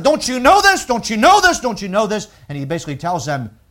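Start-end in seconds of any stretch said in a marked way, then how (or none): 0.0-2.3 loud, high pleading "Don't you know this?"; urgent apostle. Retold or imitated. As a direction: press the question hard on them. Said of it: "he keeps telling them".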